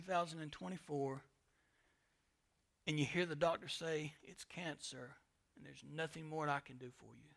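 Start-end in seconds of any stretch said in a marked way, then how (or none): 4.2-7.3 much softer, hushed delivery of bad news; doctor's grim news. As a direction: quietly break the news like a doctor. Doctor gives a terminal diagnosis.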